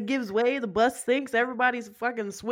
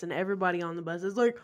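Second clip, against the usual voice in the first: usual speaking voice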